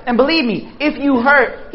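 usual speaking voice

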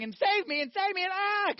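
high, loud cries for help; mock panicked scream. Drowning man in the river, panicked scream. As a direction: shout for help like someone drowning.

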